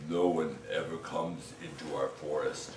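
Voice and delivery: low, soft, friendly troll; troll inviting the ghost to a game, low and soft. Acted. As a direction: invite him to play like a shy giant. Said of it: "the next troll stepped forward and he said".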